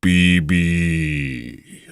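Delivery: long drawn-out "Bibi" as a missile noise; flying missile cry. Acted. Imagining a warhead flying through the sky, drawn-out missile cry. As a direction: stretch the last syllable, letting it sink away. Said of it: "this thing flying through the sky going".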